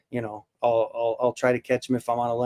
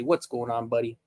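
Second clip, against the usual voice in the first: softer, lower, gentle hello; soft greeting; 4 semitones lower, 6 dB quieter than usual. Real, not acted. Quietly greeting the buddy with a gentle, tender hello. Greeting the same arrival softly.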